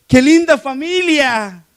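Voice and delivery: slow, high admiring exclamation; admiring gush. Retold or imitated. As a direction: gush over the lovely family, drawn out. (exclamación admirada, lenta y aguda)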